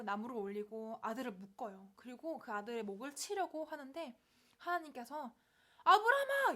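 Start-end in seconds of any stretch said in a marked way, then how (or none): 5.8-6.5 sudden high, loud cry to stop him; urgent shout. Acted. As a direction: shout the name to halt him at once. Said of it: "이러면서 급하게 막으세요". (급하게 막는 높은 외침)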